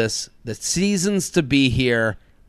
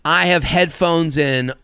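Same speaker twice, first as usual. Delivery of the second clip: ordinary delivery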